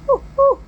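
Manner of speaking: two short high, loud woos; high-pitched whooping cheer; excited whoop. Retold or imitated. Brother cheering about V-Bucks, excited whoops.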